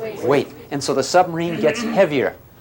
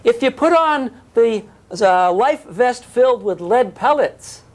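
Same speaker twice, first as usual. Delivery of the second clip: slow, higher, deliberate voicing; a teacher slowly acting out a misconception; slow mock claim; 9 semitones higher than usual.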